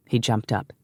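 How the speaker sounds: ordinary delivery, nothing marked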